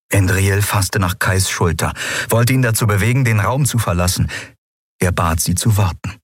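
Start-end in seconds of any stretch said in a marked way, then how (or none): none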